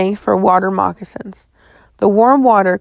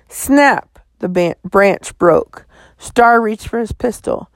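ordinary delivery, nothing marked

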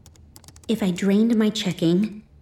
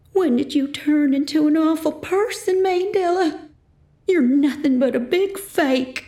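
higher, hurt mother's outburst; 10 semitones higher than usual. A narrator's reading. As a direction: lash back, wounded and shrill.

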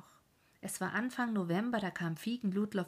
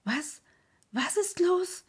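high, startled stammer; stammered alarm; 10 semitones higher, 6 dB louder than usual. Acted. Farmer bursting in on the crying crowd, stammering in fear. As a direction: blurt it out, shaken and frightened. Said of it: "stotterte er".

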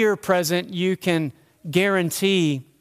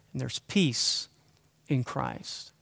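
soft tender assurance; 6 semitones lower, 9 dB quieter, slower than usual. Real, not acted. Tender close of point.

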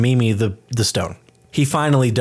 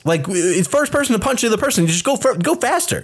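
much higher, worked-up pleading with a player; mock exasperation; 9 semitones higher than usual. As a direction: heckle a hapless player in a high voice. Exasperated advice yelled at film character.

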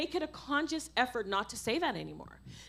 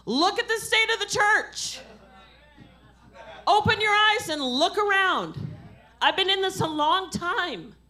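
raised, higher sermon voice; forceful preaching; 6 semitones higher, 4 dB louder than usual. Real, not acted. Preacher urging an apologetic church to wake up, forcefully. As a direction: challenge the congregation with punched, ringing words.

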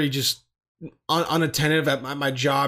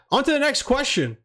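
perky question read; 8 semitones higher than usual. Real, not acted. Reading the next question.

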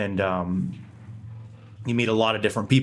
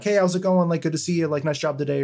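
cheery camp greeting; 7 semitones higher than usual. Retold or imitated. Campmate greeting a passerby on the road, cheery and higher. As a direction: greet a stranger like an old friend. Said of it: "they're like".

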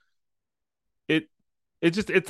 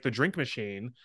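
usual speaking voice

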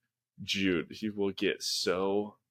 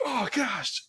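mock dismay; 14 semitones higher than usual. Dad reacting to screaming son.

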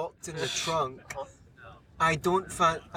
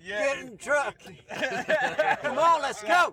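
put-on a Scot hams up a Texas accent, calling someone into the truck; 8 semitones higher, 4 dB louder than usual. Friends egg him on to do Texas, he shouts Texas accent. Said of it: "Yeah, do Texas".

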